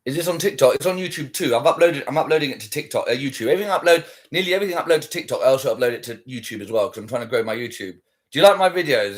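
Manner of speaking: ordinary delivery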